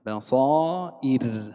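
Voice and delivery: بصائر drawn out slowly, ending on a thin, light ر; slow teacher's model of بصائر, final ر unvowelled and thin. Shown as a demonstration. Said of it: "هكذا ننطقها مرققة".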